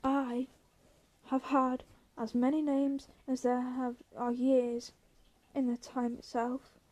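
ordinary delivery

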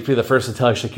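usual speaking voice

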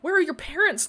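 high, loud urgent demand. A narrator's reading. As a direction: demand answers from the dazed friend. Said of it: "Glory demanded".

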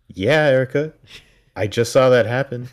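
loud, high-pitched exasperated outburst. Acted. Viewer talking back to sceptical Erica, loudly exasperated. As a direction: scold her in loud disbelief. Viewer's mock scolding of a character.

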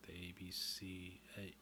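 ordinary talk

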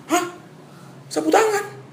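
high, incredulous "Hah? Sapu tangan?"; can't believe it was over a handkerchief; stunned disbelief. Retold or imitated. Boy can't believe the teacher's anger, high disbelief.

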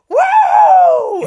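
high, loud joyride whoop. Retold or imitated. Driver whooping alone round the freeway loop, loud whoop. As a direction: let out a full shouted "woo". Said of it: "And I just went".

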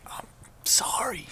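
whispered mock apology; Nicolas Cage. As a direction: whisper it softly, imitating a movie line. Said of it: "He looks at him and goes".

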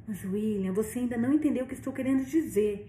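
ordinary delivery

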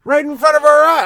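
getting riled up as the rant peaks; real worked-up rant, pitch climbing high. Real, not acted. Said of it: "I'm getting a little worked up".

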